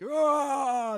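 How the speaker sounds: high, loud cheering cry; crowd cheer. Acted. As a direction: whoop like a whole crowd. Crowd throws up a yell.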